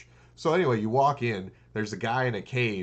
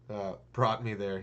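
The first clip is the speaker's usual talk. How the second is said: ordinary talk, nothing marked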